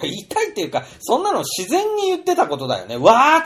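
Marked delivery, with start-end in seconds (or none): 3.0-3.4 a high, loud mock cry of delight at the dishes; excited wow. To the listeners, showing the wow diners want to let out, high and loud. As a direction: act like a guest wowed by the feast. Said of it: "とか".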